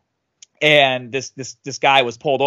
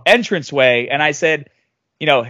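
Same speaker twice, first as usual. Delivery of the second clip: ordinary delivery, nothing marked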